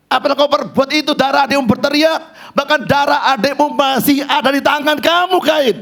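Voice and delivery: high, fast divine rebuke; God rebuking Cain. Acted. God confronting Cain over his brother's blood, high and fast. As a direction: thunder at Cain as an accusing God.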